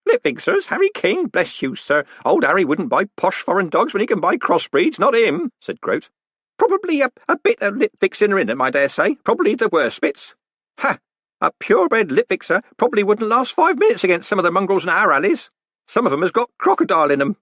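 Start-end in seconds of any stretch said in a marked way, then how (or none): 0.1-5.5 put-on high-pitched, scoffing old postman; scoffing old Groat. A narrator's reading. Groat correcting Moist about Harry King, scoffing. 6.6-17.4 put-on high-pitched, scoffing old postman; scoffing old Groat. A narrator's reading. Groat correcting Moist about Harry King, scoffing.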